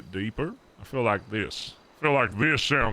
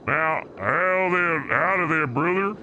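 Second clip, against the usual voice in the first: trucker voice; 5 semitones higher than usual. Pretend. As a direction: hail your brother like a good-ol' trucker. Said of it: "How's that sound?".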